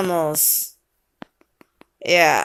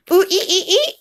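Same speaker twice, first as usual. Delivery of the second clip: high squealed monkey call; monkey sounds; 10 semitones higher than usual. Pretend. Parent prompting child with monkey noise, high squeals.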